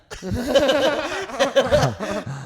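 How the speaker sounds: loud high laugh. Real, not acted. Laughing hard while telling friends about the hookup.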